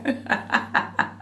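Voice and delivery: real laugh without words. Real, not acted. Storyteller laughing after the assistant's offer, a real laugh. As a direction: break off into laughter.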